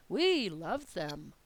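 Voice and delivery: "We" pressed hard, words slowed right down; stress on we. Shown as a demonstration. Teacher contrasting "We" with the mother, stressing it. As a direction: lean on "We" as if mother disagreed. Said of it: "extra stress on those, on the word we".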